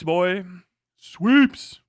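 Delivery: usual speaking voice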